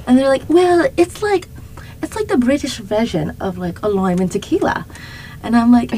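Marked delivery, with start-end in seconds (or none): 0.5-4.8 attempted British accent slipping into Australian; higher-pitched botched British, Australian on the vowels; British turning Australian. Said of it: "Getting Australian".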